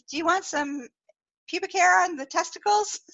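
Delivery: put-on nail girl asking. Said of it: "she's like".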